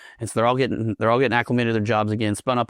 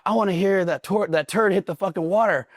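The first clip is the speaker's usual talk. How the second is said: high, half-laughing DI command; mock drill instructor; 9 semitones higher than usual. Drill instructor demanding to hear the turd, laughing mock bark. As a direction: play the absurd drill sergeant.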